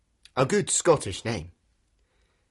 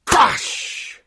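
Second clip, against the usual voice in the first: loud explosive crash with long hushing tail; noisy crash after a countdown; big crash; 11 semitones higher, 5 dB louder than usual. Shown as a demonstration. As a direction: burst it out like an impact. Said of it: "Shall we do a big crash together?".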